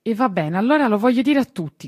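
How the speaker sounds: loud, high, tearful outburst; a child crying out his resolve; shouting. Acted. Tistù shouting after hearing of the lost garden, loud and high. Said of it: "gridò".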